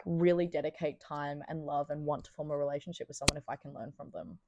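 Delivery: ordinary talk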